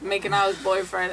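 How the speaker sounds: normal talking voice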